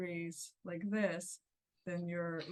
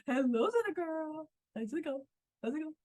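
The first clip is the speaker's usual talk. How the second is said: higher, playful, sing-song greeting; raised playful tone; 9 semitones higher than usual. Shown as a demonstration. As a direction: lift her up with a playful high voice. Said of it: "raising the tone".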